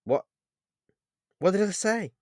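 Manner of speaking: high, startled question; surprised. A narrator's reading. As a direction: ask it taken aback, faltering at the start. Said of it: "I asked with surprise in my voice".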